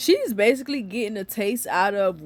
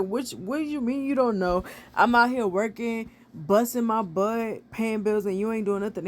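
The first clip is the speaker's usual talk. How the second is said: ordinary talk, nothing marked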